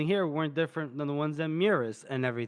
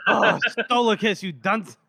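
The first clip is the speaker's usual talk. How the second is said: laughing; 6 semitones higher, 5 dB louder, slower than usual. Retold or imitated. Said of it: "she goes".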